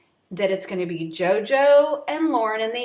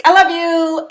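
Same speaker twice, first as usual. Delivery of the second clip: a bright, louder, heartfelt I love you; gushing I love you; 5 semitones higher, 7 dB louder than usual. Retold or imitated. Imitating JoJo telling Ben she loves him, heartfelt. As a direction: blurt it out with real feeling. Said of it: "she just kind of like".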